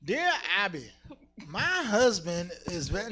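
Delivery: put-on slow Borat-style accent. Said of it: "I just did Borat".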